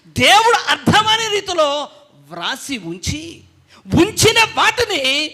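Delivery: high, sustained pulpit cry; impassioned preaching. Real, not acted. Preacher lamenting to congregation that people are being deceived, impassioned. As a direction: preach it fervently, pitched high.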